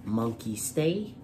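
ordinary delivery

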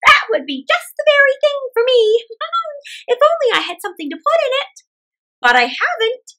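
put-on high, loud old woman voice, pleased; chatty old woman thinking aloud; cheerful old woman. Old woman muses over the pot in the ditch.

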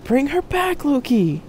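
high, strained cry; desperate plea. A narrator's reading. Jack begging Loki to bring Marcia back, desperate cry. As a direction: plead at a high pitch.

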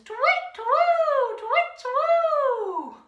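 slow, high, drawn-out owl calls; owl hoots. Acted. Leader doing the owl's call in the toddler rhyme, slow high hoots. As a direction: hoot twice like a wide-eyed owl. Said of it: "Lovely owl noises there".

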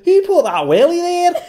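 very high, loud Scottish accent. Shown as a demonstration. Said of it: "It's a bit more like that".

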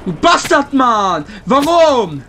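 loud frustrated cursing; angry yell. Real, not acted. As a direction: yell it at the enemy. Cursing the enemy after death.

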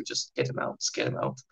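breathy whisper under the breath; whispered aside. Retold or imitated. Guards muttering to each other about the old man, whispered. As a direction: hush it like a secret aside.

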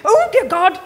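high, loud theatrical shriek. A narrator's reading. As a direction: shriek it in mock horror. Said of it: "Dad shrieked".

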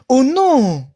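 ordinary delivery

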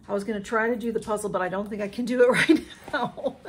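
loud, quick, breaking into a laugh; overwhelmed laughing. Real, not acted. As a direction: laugh helplessly through the words. Overwhelmed, laughs off postponing puzzle.

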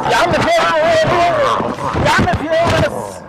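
frightened cry. Real, not acted. Passenger frightened by reckless driving.

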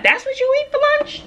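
high, incredulous question; mock disbelief. Quoting a coworker's shocked question about her lunch, high and incredulous.

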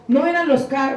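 usual speaking voice

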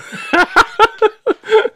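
loud high-pitched laugh; high cackling laugh; high laughter. Real, not acted. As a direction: shriek with laughter. Laughing hard.

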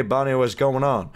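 usual speaking voice

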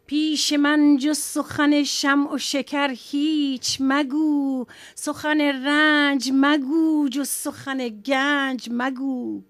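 loud, declaimed Persian poem recital. Acted. Poet reciting Rumi to the TV audience, loud and declaimed. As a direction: declaim the verses with full force to the audience.

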